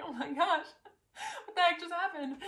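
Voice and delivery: high and laughing through tears; half laughing, half crying in disbelief; giddy tearful laugh. Retold or imitated. Crying and laughing after crowning.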